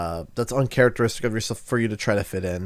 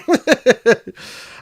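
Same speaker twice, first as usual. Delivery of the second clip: high whiny cartoon chuckle; Bubble Bass laugh; 11 semitones higher, 5 dB louder than usual. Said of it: "since I did the Bubble Bass voice".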